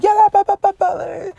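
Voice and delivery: high-pitched, mock excited baby chatter; excited toddler babble. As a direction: imitate a little girl babbling excitedly. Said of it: "She's so excited".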